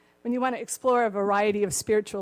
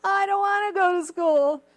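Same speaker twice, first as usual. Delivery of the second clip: put-on higher, louder whining kid voice; whiny son; 9 semitones higher, 10 dB louder than usual. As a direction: whine like a kid who won't get out of bed. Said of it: "the son says".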